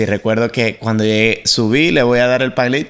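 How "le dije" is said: usual speaking voice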